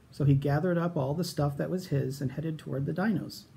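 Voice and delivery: ordinary delivery, nothing marked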